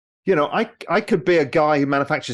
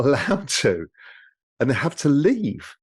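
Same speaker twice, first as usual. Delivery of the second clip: ordinary talk, nothing marked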